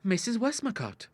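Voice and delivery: put-on high-pitched girlish suggestion; sly. A narrator's reading. Daughter suggests the neighbour to father, slyly.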